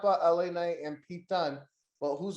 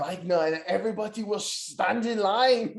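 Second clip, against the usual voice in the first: put-on German accent; 3 semitones higher than usual. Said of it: "he's got, like, a German Nazi gimmick".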